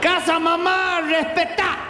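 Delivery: put-on high, slurred drunk babble; a drunk who can't conjugate verbs; drunk brother. Drunk brother Freddy talks from the lawn chair, slurred and high. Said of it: "del pedo que tiene".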